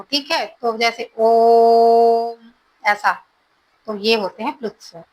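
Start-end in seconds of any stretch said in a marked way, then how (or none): 1.2-2.5 ओम held long, three beats, swelling louder at the end; drawn-out om. Shown as a demonstration. Teacher showing students a pluta vowel, drawn-out chanted om. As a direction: stretch ओम out like a chant. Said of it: "ऐसा बोल सकते हो".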